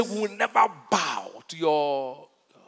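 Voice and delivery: slow, drawn-out refusal; acted defiance. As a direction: stretch each word in solemn refusal. Acting refusal to the king.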